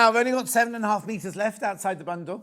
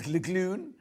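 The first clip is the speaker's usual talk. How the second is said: Lagoon said through a laugh; amused at her own stumble; laughing at herself; 2 semitones higher than usual. Real, not acted. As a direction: giggle at fumbling the word. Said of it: "God, I can't even say it".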